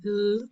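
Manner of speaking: "l" held long and dark, tongue back. Shown as a demonstration. Teacher isolates the end of call, held dark "l". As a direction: draw out a dark "l" on its own. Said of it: "con dark L".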